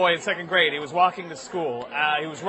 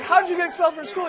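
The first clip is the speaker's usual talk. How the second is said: high, fast incredulous question; alarmed mom; 10 semitones higher, faster than usual. Acted. Mother questions her expelled son, alarmed. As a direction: fire back a shocked mother's question. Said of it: "She's like".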